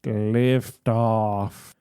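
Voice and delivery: low, evenly spaced countdown machine; mechanical announcer. A narrator's reading. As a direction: count down like a launch computer. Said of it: "a mechanical voice announces".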